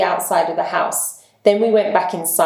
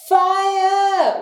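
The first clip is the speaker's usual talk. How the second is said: a long, very high yell; shouted; 12 semitones higher than usual. Acted. The group shouting fire in the passage, a high long yell. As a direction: yell it up high at full stretch. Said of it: "we shouted as loudly as possible".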